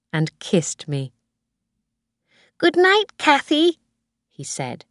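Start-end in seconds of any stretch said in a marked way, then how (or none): 2.6-3.8 put-on high-pitched little boy; Tim. A narrator's reading. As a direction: say good night like a small child. Small boy says good night.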